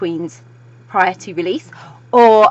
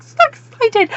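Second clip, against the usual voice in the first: high, loud giddy squeak; high-pitched burst of excitement; excited squeal; 7 dB louder, slower than usual. Acted. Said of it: "I know I'm talking so fast".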